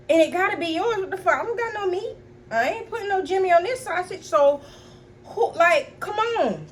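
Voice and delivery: very high-pitched, exasperated protest; indignant. Retold or imitated. Indignant argument over whose it is.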